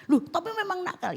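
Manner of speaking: protesting mother. Pretend. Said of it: "imagined objecting mother".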